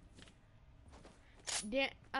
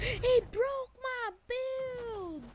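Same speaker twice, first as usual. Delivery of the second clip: dismayed cry; 9 semitones higher, much slower than usual. Real, not acted. Build destroyed, dismayed cry.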